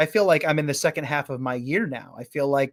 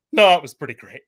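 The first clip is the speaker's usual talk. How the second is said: Patrick voice; 7 dB louder than usual. Retold or imitated. Answering a coworker about the weekend, deadpan. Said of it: "I turned it to Patrick".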